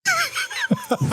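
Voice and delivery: tiny high giggle; a polite little-girl laugh; imitated little giggle. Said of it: "and go".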